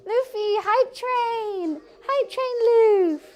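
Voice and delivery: excited pet talk. Real, not acted. Cheering pet for hype train.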